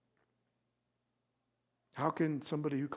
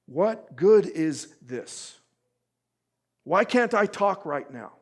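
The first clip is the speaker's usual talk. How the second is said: Job's anguished plea; 5 semitones higher, 10 dB louder, slower than usual. Retold or imitated. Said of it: "He's saying".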